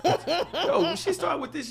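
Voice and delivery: shrieking with amusement; laughing. Real, not acted. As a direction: laugh high through it. Cracking up at her reply.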